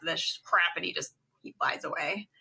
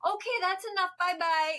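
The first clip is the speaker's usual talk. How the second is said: high dismissive goodbye; 11 semitones higher than usual. Retold or imitated. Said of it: "he goes".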